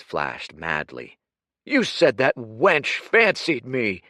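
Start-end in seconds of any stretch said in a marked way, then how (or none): 1.6-4.0 loud, high, raging accusation; furious shouting. Acted. Humiliated Kuruk shouting down Dorian, furious. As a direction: rage at the betrayal.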